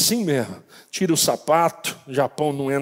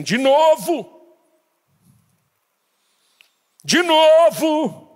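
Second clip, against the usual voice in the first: loud, high, slow commanding shout; slow stretched shout of De novo; shouting voice of God; 10 semitones higher, 6 dB louder, much slower than usual. Retold or imitated. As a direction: cry it out like an inner voice ordering him. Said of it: "aquela voz gritava dentro de mim".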